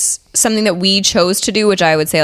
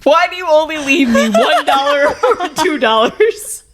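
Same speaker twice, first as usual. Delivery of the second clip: reading aloud through laughter, higher; higher, laughing as she reads; 8 semitones higher than usual. Real, not acted. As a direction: read it while cracking up. Reading letter while laughing.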